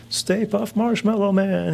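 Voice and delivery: sing-song parade amazement; mock childhood wonder. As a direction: stretch it out high like an awed kid. Kid reacting to parade balloons.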